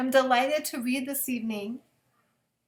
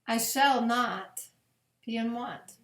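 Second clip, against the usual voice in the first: slow, reverent psalm reading with long pauses; 3 semitones lower than usual. Acted. Pastor reading Psalm twenty-three at bedtime, slow and reverent. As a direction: let each verse land gently, slowly.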